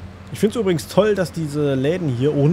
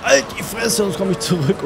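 mock snarl; 6 semitones higher than usual. Snapping at a game character.

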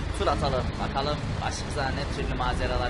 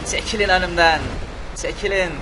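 shouting at people; 2 semitones higher, 6 dB louder than usual. Pretend. Driving tank through fleeing crowd.

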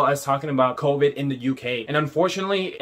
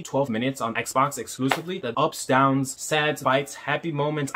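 ordinary talk, nothing marked

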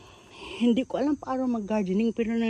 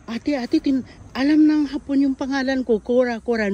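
excited sister; 6 semitones higher, 5 dB louder than usual. Retold or imitated. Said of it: "sabi niya".

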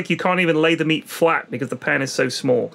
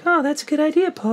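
[name]; 11 semitones higher than usual. Acted. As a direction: put on a high, innocent falsetto. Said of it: "And Michael was like".

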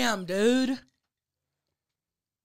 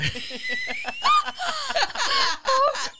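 high, loud cackling laughter; loud laughing fit; 6 dB louder than usual. Real, not acted. Host cracking up at the thought of Mike listening, high laughter. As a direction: burst out in high shrieking laughter.

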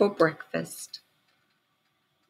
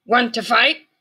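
grouchy ladybug voice; 6 semitones higher, 5 dB louder than usual. A narrator's reading. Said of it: "said the grouchy ladybug".

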